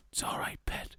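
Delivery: soft unvoiced whisper, a granny soothing; whispered comfort to a scared man. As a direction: breathe it like a kind old granny. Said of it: "just going".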